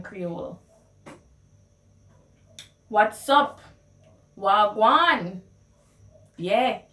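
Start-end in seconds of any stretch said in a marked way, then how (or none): 4.4-5.4 loud, friendly Wagwan; Jamaican Patois greeting. Shown as a demonstration. Greeting a friend, in Jamaican Patois. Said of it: "how you would translate".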